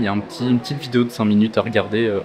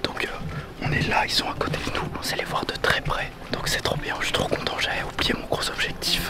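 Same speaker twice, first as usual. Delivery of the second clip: hushed whisper near sleeping animals; library whisper; 10 dB quieter than usual. Real, not acted. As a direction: whisper so nothing wakes. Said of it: "faut pas faire de bruit, c'est pour ça que je parle tout bas".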